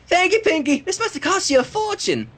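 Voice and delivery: put-on a touch of child gratitude; grateful filly voice. Apple Bloom thanks Pinkie.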